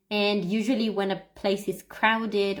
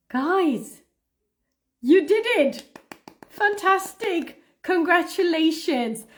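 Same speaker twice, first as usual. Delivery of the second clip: excited praise; 5 semitones higher, 4 dB louder than usual. Real, not acted. Celebrating learners finishing the stream.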